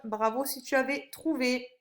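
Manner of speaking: usual speaking voice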